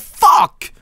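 high screamed curse, real shock. Real, not acted. Streamer yells after spotting his own house. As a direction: yelp it out in a panic.

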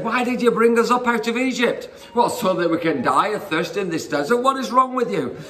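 high-pitched, hammed-up grievance; complaining. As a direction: act out an aggrieved crowd accusing its leader. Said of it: "complaining to Moses".

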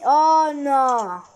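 long, drawn-out groaning cry; dismayed. Real, not acted. Gamer unable to get down in game, dismayed. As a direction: drag it out in dismay.

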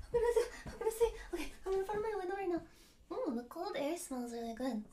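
soft, low murmur at the window; quiet, under-the-breath talk; hushed, nervous. Real, not acted. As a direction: keep it down as you psych yourself up. Nervous at open window before singing.

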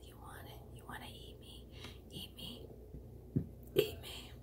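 tempting whisper of a talking potato. Pretend. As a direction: whisper like food luring you. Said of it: "It's like".

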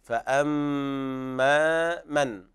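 فأما with the doubled meem held nasally, very slow; ghunna on meem. Shown as a demonstration. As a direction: stretch the mm of فأما in a long nasal hum. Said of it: "والميم حرف غنة مشدد". (غنة الميم المشددة في فأما)

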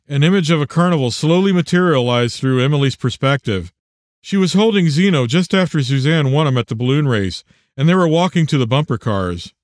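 ordinary delivery

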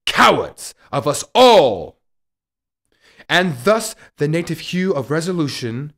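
explosive declaimed shout on stage; loud, impassioned Shakespearean cry; theatrical outcry. Acted. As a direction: hurl it out at full force. Climax of Hamlet's soliloquy.

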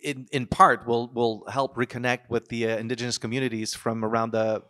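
ordinary talk

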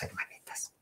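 soft creeping whisper; hushed suspense. Shown as a demonstration. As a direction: whisper it as if sneaking. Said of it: "pues ahí vamos".

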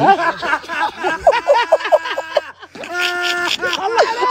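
long high cackle of laughter. Real, not acted. Friend cackling after boasting nobody beats him. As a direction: burst out laughing hard.

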